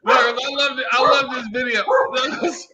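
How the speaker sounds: high, laughing excited outburst; gushing and cracking up. Real, not acted.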